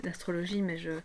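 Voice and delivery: ordinary talk, nothing marked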